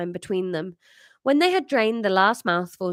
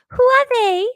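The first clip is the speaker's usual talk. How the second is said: put-on high, loud child's voice in French accent; rude child; 10 dB louder than usual. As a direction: blurt it like a cheeky child. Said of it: "casual rudeness of a child".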